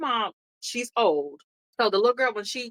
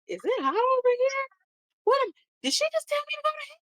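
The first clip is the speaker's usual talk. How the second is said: high, quick, bewildered voice; mock-confused muttering to oneself; confused victim. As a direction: play the baffled one who just got cursed out sweetly.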